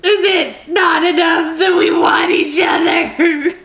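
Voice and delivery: loud tearful cry, pitched high and slow; crying outburst. Real, not acted. Reader to viewers, crying out the book's line. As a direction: cry the line out as it overwhelms you. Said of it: "I'm gonna cry".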